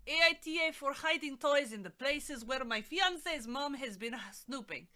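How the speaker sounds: loud, hammed-up Russian accent; amateur Russian accent, projected loudly. Shown as a demonstration. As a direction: read it out in a big, rough-and-ready Russian accent. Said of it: "All right, we'll try to do Russian".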